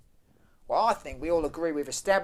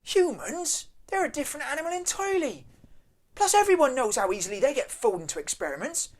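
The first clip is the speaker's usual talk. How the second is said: guinea pig voice; 6 semitones higher than usual. Acted.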